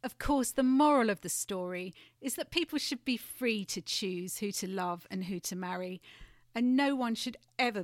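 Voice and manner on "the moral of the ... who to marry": ordinary delivery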